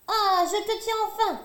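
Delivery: high, triumphant angry cry; angry exclamation. A narrator's reading. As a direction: crow over the trapped rabbit. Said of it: "s'exclame Hiawatha en colère". (Hiawatha coince le lapin, en colère)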